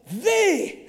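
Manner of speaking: one high word of hammed-up awe; awestruck mock exclamation. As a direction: imitate a stunned admirer, high pitched. Retold compliment, word by word.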